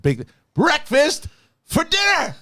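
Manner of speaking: high, slow showman announcement; Willy Wonka pitch. Retold or imitated. Dad pitching breakfast for dinner, Willy Wonka showman. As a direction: sell it like a magical treat. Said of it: "I'm like Willy Wonka selling this shit".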